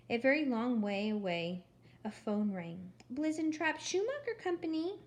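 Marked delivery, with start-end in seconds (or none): 3.1-5.0 high, sugary receptionist voice, pitched up; annoyingly sweet voice. Acted. Gladys answering the phone at the company, annoyingly sweet. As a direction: answer the phone sickly sweet and far too pleasant. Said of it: "said an annoyingly sweet voice".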